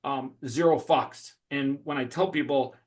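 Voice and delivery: usual speaking voice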